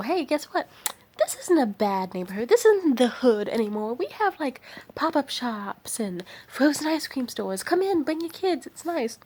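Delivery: high-pitched, upbeat sales pitch to friends; enthusiastic newcomer. Acted. Voicing a newcomer inviting friends over.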